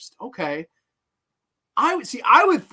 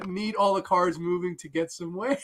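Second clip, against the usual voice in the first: ordinary talk, nothing marked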